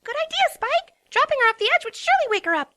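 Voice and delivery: high, fast, bright voice; high rapid eager pony; cheerful Pinkie. A narrator's reading. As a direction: chirp out a bad plan happily. Pinkie's bubbly wrong idea.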